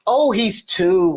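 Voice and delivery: usual speaking voice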